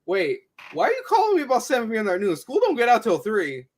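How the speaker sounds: confused parent on phone. Pretend. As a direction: play a puzzled mom answering a strange call. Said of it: "imagine getting that call at noon".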